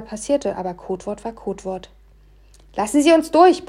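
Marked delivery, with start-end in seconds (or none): 2.8-3.7 loud, high-pitched yell; brüllend. A narrator's reading. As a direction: shout it out urgently to clear a path. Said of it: "brüllte ich sofort". (laut gebrüllt)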